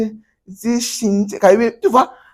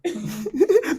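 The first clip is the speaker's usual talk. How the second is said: high laugh. Real, not acted. Laughs at student's reading.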